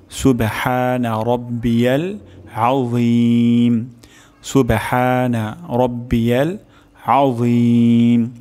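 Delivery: slow "সুবহানা রব্বিয়াল আযীম", vowels held long; measured recitation, stretching "আযীম" with dental dh; correct recitation. Shown as a demonstration. Teacher repeating the ruku tasbih for learners, slow correct recitation. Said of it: "সহিহ উচ্চারণ শিখব".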